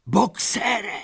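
very high, anguished call; desperate cry. A narrator's reading. Mare sees Boxer at window, desperate cry. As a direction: cry the name in despair. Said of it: "křičela zoufale Lupina".

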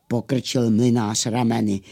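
plain reading voice

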